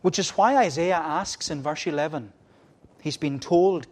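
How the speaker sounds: usual speaking voice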